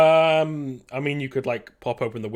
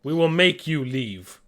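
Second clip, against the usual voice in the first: slow, firm threat; big guy's measured menace; threatening native; 3 semitones higher, 5 dB louder, slower than usual. Acted. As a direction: warn them slowly and forcefully.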